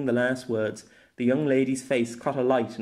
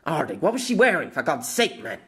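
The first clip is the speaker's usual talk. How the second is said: higher, frustrated self-reproach; exasperated self-scolding; 4 semitones higher than usual. Acted. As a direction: scold yourself for forgetting. Scolding himself to remember.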